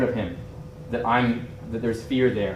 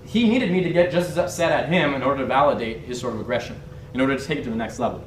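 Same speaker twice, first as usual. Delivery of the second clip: normal talking voice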